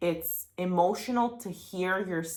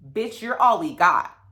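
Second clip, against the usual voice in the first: fierce self pep talk; 5 semitones higher, 10 dB louder than usual. Retold or imitated. Quoting her fierce self-talk recording.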